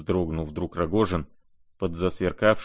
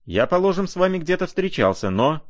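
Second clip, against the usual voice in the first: much higher, haughtily strained voice; raised voice; 10 semitones higher than usual. A narrator's reading. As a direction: pitch it right up in affronted protest. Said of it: "возвысил голос Ганя". (высокий возмущённый голос)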